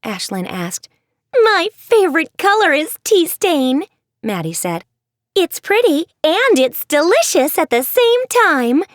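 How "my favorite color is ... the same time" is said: much higher, louder giddy voice; a showoff bursting to share; zany Maddie. Acted. Maddie to her friends, giddy and showy.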